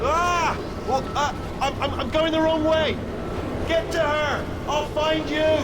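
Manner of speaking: high, urgent call while being carried away; alarmed shouting. Acted. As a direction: yell instructions as you get dragged off. Swept the wrong way, calls to companion.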